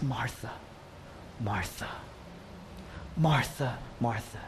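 very low, hushed, tender calling; softly acted sorrow, long pauses between names; tearful compassion. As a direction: nearly whisper the name with aching pity. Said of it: "in his compassion, he's crying".

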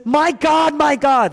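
high, loud anguished call; emotional crying out. Acted. Jesus on the cross addressing his Father, crying out. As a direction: call out with full feeling. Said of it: "He's emotional".

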